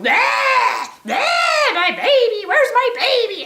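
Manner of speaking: very high, loud bleating mother; frantic mama goat. Retold or imitated. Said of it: "And she's freaking out".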